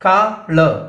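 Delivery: കളർ split in two, loud and high; syllable by syllable. Shown as a demonstration. Teacher showing syllables, കളർ split with first-syllable stress. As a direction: break കളർ apart, strong on the first part. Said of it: "first syllable ആണ് stress".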